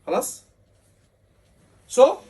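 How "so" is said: raised, louder teacher warning; emphatic warning. Real, not acted. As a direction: drive the warning home loudly. Teacher warning the class loudly.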